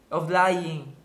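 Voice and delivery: usual speaking voice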